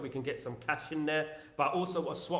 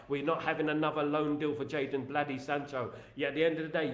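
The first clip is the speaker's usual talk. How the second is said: normal talking voice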